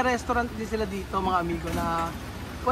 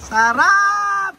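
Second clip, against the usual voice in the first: excited; 9 semitones higher, 5 dB louder than usual. Real, not acted. Tasting coconut ice cream.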